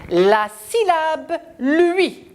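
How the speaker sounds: slow, high, syllable by syllable; slow model reading. Shown as a demonstration. Teacher modelling a syllable for children. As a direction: read it slowly for the class to repeat. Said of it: "On y va, mes petits".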